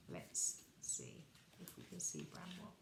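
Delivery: soft, hushed whisper; whispered aside. Real, not acted. Reader looks at the picture with child, whispering.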